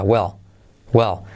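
"well" with the final L almost dropped; "well" with a very faint L; barely pronounced L. Shown as a demonstration. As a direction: let the L of "well" fade to almost nothing. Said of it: "la L que casi no se pronuncia".

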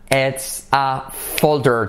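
slow, carefully spaced words; slow teacher model; slow model phrase. Shown as a demonstration. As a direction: say it slowly for the class to repeat. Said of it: "Come on".

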